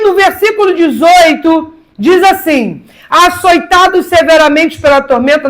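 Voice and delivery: loud, high-pitched fervent preaching; impassioned sermon delivery. Acted. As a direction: preach it out to the congregation, full force. Preaching at full force.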